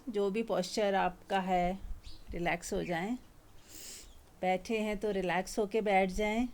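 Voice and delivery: calm, unhurried meditation guidance; guided meditation. Acted. Teacher guiding a satsang group to relax, gentle meditation voice. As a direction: ease the listeners into relaxing.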